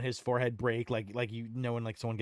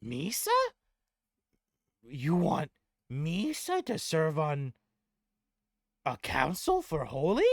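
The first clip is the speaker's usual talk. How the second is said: higher, louder goblin, slowly questioning; Gob in disbelief; 8 semitones higher, 8 dB louder than usual. Acted. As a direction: ask in squeaky goblin disbelief. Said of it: "As Gob looks around at everyone saying".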